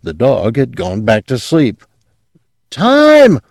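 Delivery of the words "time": piercing very high shriek; frantic high loud shriek. A narrator's reading. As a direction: scream it in panic. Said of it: "the clerk shrieked".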